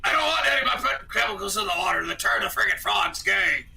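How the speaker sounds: loud, shouting conspiracy ranter; Alex Jones impression. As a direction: bellow it like an angry radio host. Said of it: "I'll sound just like Al".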